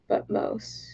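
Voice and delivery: soft unvoiced whisper. Real, not acted. Streamer whispering a quick correction to chat. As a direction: breathe it out under your breath.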